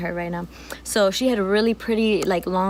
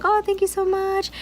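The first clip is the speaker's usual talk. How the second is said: much higher thankful gush; grateful gush; 11 semitones higher than usual. Retold or imitated. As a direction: gush your thanks high. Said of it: "I was like".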